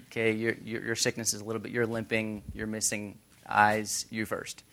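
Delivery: low, muttered triage of a queue; offhand ushering. Acted. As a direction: sort people along under your breath. Voicing disciples sorting the sick.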